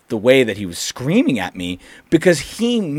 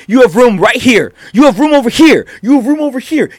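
much higher and louder, insisting; retold angry shouting, high; yelling customer; 13 semitones higher, 10 dB louder than usual. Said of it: "And he's like".

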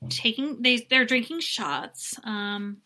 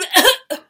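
mock gagging cough; 9 semitones higher, 10 dB louder than usual. Imitating him vomiting.

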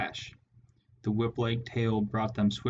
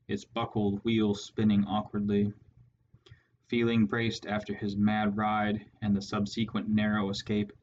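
ordinary delivery

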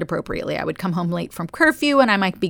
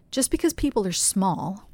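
ordinary talk, nothing marked

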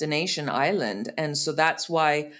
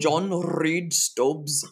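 very slow, mock Scottish with rolled R's; hammed-up Scottish name intro; much slower than usual. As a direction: roll every r like a Scot saying his name. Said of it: "I hadn't heard any R's".

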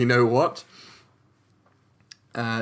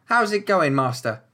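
halfling voice; 6 semitones higher than usual. Shown as a demonstration. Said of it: "let me think of a better voice".

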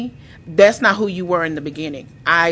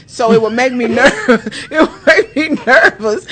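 higher, louder, laughing through the words; 5 semitones higher, 5 dB louder than usual. Real, not acted. Wife recalling her loud husband, laughing as she talks. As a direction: let real laughter break into the telling.

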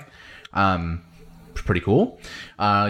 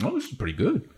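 a light, softer lizard-creature voice; lightly voiced Saurian, pleased with the churro; 6 semitones higher, 4 dB quieter, faster than usual. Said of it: "The Saurians all grab a churro".

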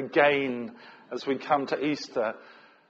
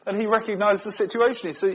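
ordinary delivery

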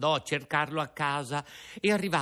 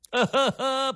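nervous laugh; 13 semitones higher, 6 dB louder than usual. A narrator's reading. Laugh before Pinocchio cries.

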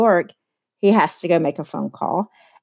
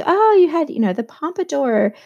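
lightly imitated gushing Daisy, pitched up; mildly acted, higher-pitched exclamation; voicing Daisy; 6 semitones higher than usual. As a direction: quote her with a high "oh" and a lift. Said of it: "So Daisy's like".

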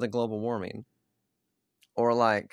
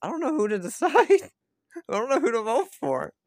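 confused voter; 13 semitones higher, 5 dB louder than usual. Retold or imitated. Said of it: "the rest of the people are just like".

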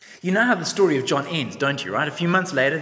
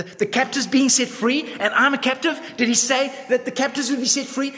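higher, louder, urgent questions; anxious pleading; 9 semitones higher, 5 dB louder than usual. Retold or imitated. John in prison pleading with disciples, higher and louder. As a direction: press the captives question, worried.

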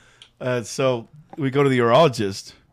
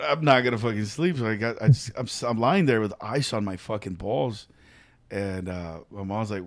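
normal talking voice

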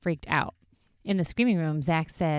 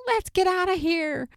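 very high, hammed-up cry to flee; mock panicked yell; 14 semitones higher, 3 dB louder than usual. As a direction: imitate frightened men bolting away. Acting out their panicked escape.

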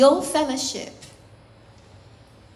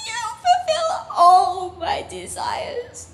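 God overjoyed; 5 semitones higher than usual. Acted. God welcomes the striving believer, crying out in delight. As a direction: cry out with overflowing joy. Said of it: "he goes".

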